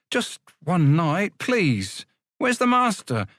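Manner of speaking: slow then rising, pleading high; pleading boy. Acted. Boy begging the porter for one night, pleading.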